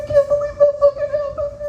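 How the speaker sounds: very high, squealed fake sobbing; mock ugly crying. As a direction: wail it like a hammed-up blubbering teen. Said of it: "One of them's just ugly crying".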